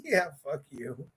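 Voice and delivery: ordinary talk, nothing marked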